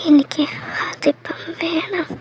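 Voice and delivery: slow, breathy pleading under the breath; pleading whisper. Acted. Wife whispering to her husband, pleading slowly.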